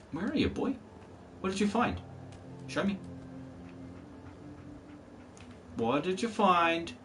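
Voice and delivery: high coaxing pet-owner voice; talking to Dogmeat like a pet; calling the dog. Acted. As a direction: coax the dog out like calling a puppy.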